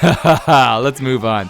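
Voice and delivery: loud laugh into the words; real laugh, brushing off the emotion. Real, not acted. Host moving on after nearly crying on video, laughing it off.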